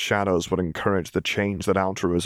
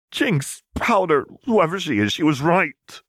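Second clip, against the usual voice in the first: spat out; 10 semitones higher, 4 dB louder than usual. A narrator's reading. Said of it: "Vi spat".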